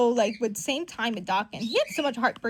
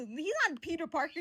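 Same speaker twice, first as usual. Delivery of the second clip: usual speaking voice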